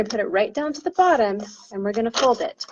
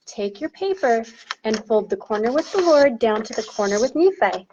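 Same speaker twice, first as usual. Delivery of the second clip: usual speaking voice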